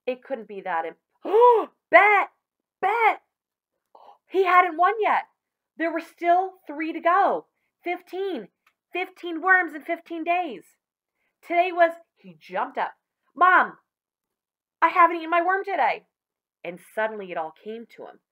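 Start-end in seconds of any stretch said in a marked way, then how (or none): 1.2-11.9 high, loud excited burst; boy suddenly remembering the bet; excited realization. A narrator's reading. 13.3-16.1 high, loud and fast; boy shouting to his mother in a rush; urgent call to Mom. Acted. Billy calls to Mom urgently.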